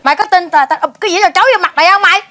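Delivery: sharp yell, then a quick threat; angry threat. Acted. Jealous wife threatens to dump the plate, angry. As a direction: cut them off and threaten him fast.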